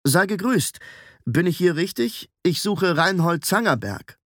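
courteous inquiry; polite greeting. A narrator's reading. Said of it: "sprach ihn höflich an".

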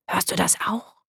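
hushed whisper, listening out; whispered question. A narrator's reading. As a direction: whisper it secretively. Daka fragt heimlich beim Lauschen. (geflüsterte Frage)

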